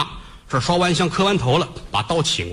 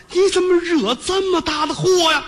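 crying reproach; 13 semitones higher than usual. Acted. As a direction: wail 你 high and hard, falling at the end. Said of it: "眼泪儿是哗哗的止不住了".

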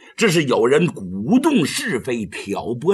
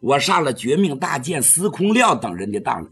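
usual speaking voice

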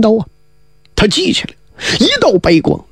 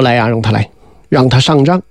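low-pitched summons; lightly voiced commander; 8 semitones lower than usual. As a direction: drop the pitch for the old commander's order. Commander summons an officer into tent.

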